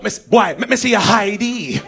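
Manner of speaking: slow, insistent patois demand; hammed-up officer repeating an order; Jamaican policeman. Policeman demanding ID from tourist, insistent Jamaican accent. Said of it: "One policeman come out of the bush".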